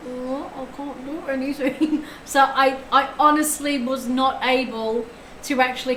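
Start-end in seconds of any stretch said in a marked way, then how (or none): 0.1-2.0 slow, drawn-out feeble moan; mock moan. Streamer recalling her aching jaw, slow mock moan. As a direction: imitate yourself too sore to talk, slowly. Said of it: "I was going".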